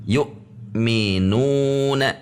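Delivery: "yu'minuna" slowly, nu held in one long swing; menekan pada nu. Shown as a demonstration. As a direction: lean on the nu of "yu'minuna". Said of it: "menekan pada nu". ("yu'minuna" dengan nu ditekan satu ayunan)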